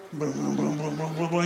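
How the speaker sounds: low rolled-lip rumbling; belly rumble. Acted. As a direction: rumble like a nervous stomach. Stomach rumbling sound. (gruñido de tripas grave)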